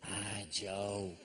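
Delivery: a hint of a whispered backstage cue; mock stage whisper. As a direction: hiss the name like a hidden prompter. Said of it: "it was all very".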